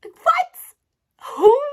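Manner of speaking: very high, loud incredulous squawk; high-pitched mock shock; shocked outburst. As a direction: burst out in exaggerated disbelief. Acted shocked reaction to a name.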